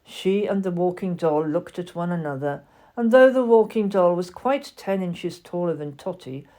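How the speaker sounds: ordinary delivery, nothing marked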